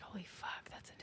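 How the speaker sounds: hushed, stunned realisation; awed whisper. Retold or imitated. Tom Holland recognising Odysseus, retold in a stunned whisper. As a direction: whisper it as if barely believing it.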